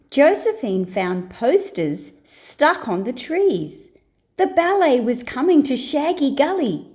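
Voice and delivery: ordinary delivery, nothing marked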